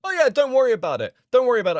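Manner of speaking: loud, high sarcastic scoff; mock his empty promise, loud and biting; sarcastic mockery.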